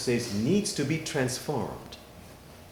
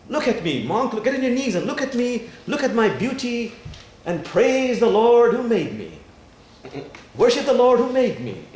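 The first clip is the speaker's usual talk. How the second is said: high, loud, self-glorifying boast; brazen; 7 semitones higher, 6 dB louder than usual. Retold or imitated. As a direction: demand admiration in a raised, imperious voice. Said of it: "spreads out its petals brazenly and says".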